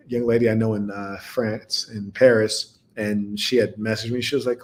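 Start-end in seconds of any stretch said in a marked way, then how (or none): none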